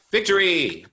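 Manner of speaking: high, loud little cry of victory; triumphant cheer. Real, not acted. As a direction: celebrate finding the button. Found the share button, triumphant.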